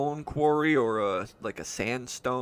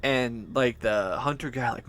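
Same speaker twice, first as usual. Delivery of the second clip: usual speaking voice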